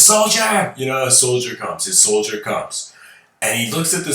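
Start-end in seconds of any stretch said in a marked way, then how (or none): 0.0-0.7 high, loud general's call; shouted summons. Retold or imitated. Third general gives in and summons his soldier, high loud shout.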